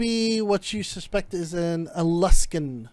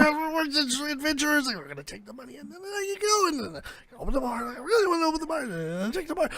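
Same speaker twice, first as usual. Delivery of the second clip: fast whiny babble; 6 semitones higher, 4 dB quieter, faster than usual. Acted. Character babbles his plan.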